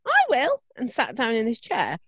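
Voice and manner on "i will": mock eager child. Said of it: "And Oscar went".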